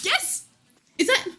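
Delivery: sudden high squeal of joy; excited squeal. Real, not acted. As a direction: shriek with delight. Squeals at new emotes.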